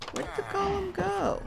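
high-pitched searching cry; exasperated calling out. Real, not acted. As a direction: call out high for someone gone missing. Calls out for missing golem.

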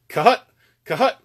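sharp high clucked "Cut, cut" imitating a goose; goose call cut. Shown as a demonstration. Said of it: "So it's cut".

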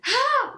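sharp high gasp of delight; thrilled intake of breath; excited gasp. Real, not acted. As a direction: gasp like you can't believe it. Opening an exciting package.

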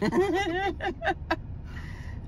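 long, high, soft wheeze of laughter; helpless laughter. Real, not acted. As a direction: laugh until out of air. Laughing at friend's mock intro.

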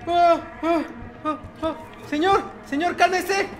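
screaming in terror. Real, not acted. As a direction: scream while being attacked. Lo atacan, grita. (gritos de terror)

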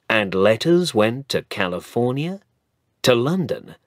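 usual speaking voice

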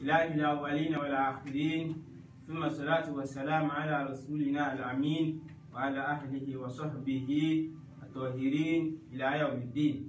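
low, quick Arabic invocation; formal opening prayer. Real, not acted. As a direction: recite the opening praise in a low, fluent run. Opens with Arabic praise formula.

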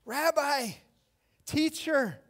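high, loud call; joyful recognition cry. Acted. Mary calls to the teacher she knew, overjoyed cry. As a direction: cry out to a long-known teacher. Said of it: "She recognized his voice".

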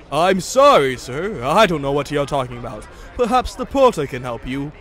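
hearty, loud engine apology; loud polite engine. Acted. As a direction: apologise like a big booming engine.

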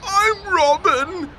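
put-on slow, high tearful girl; on the edge of tears, insisting. A narrator's reading. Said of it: "bubble just a little".

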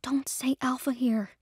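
slow urgent whisper; shushing whisper. Acted. Trinity whispers a warning to Juniper in public. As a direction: hush her so humans won't hear. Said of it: "Trinity shushed her".

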